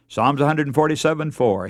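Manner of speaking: usual speaking voice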